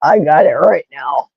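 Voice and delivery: creaky low fry on the words; vocal fry. Real, not acted. Host naming her own fried voice, creaky. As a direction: let the voice rattle into fry. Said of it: "Vocal fry".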